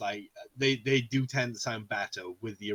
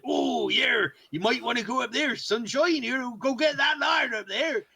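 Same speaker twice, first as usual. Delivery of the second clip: high, loud Cornish yokel voice; Cornish farmer accent; 12 semitones higher, 6 dB louder than usual. Retold or imitated. Gamer mocks Cornish dub of Dragon Quest, Cornish accent. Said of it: "Cornish accents on there, so they sound like farmers".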